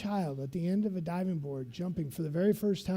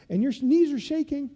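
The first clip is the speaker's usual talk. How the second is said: ordinary talk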